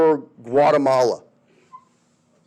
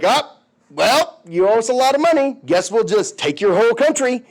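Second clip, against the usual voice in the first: smug greed; 5 semitones higher than usual. Retold or imitated. Europe smugly claiming the country.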